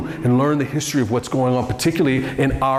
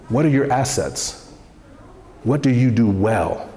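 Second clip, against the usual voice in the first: normal talking voice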